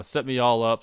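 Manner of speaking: normal talking voice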